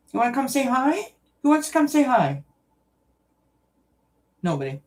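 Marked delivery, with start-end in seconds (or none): 0.1-2.4 high-pitched coaxing of a pet; pet talk. Real, not acted. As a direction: coax the cats over in a high voice. Coaxing cats to say hi.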